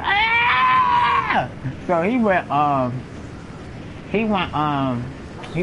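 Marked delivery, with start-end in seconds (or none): 0.0-1.5 high shouted wail; mock pained scream. Cousin after the fight, high mock scream. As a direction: let out a high wounded yell.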